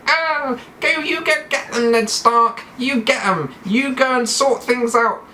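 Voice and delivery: very high, adenoidal pixie voice; blocked-nose squeak of encouragement; Colin Pixworth. Acted. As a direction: cheer him on as a squeaky pixie king. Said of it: "he's a little bit adenoidal".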